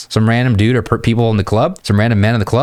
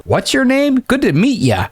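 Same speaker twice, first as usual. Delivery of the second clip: very high, mock eager greeting; high-pitched awkward politeness; clueless introduction. Shown as a demonstration. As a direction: parody a cringey, overeager hello. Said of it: "You look like an N P C chode".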